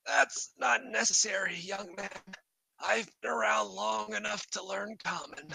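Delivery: high, soft creature voice; polite high-pitched kobold. Acted. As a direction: talk like a small elderly kobold, pitched well up. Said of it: "And he says".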